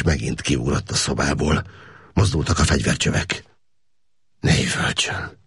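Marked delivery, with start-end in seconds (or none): 4.4-5.4 whispered. A narrator's reading. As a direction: hush him under the guns. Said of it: "suttogta Káptalan".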